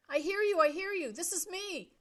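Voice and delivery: high, loud eager reply; eager shout. A narrator's reading. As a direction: shout back with relief. Brian answers on radio.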